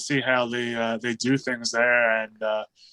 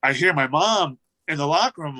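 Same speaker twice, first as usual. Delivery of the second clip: ordinary talk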